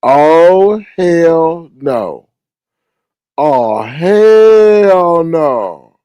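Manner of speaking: loud, drawn-out oh hell no; stretched indignant holler; outraged shout. Acted. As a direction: blast it out in disbelief.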